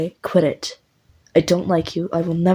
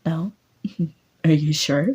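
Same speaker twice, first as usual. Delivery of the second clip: teasing laugh; 3 semitones higher than usual. Acted. Teasing the listener to smile.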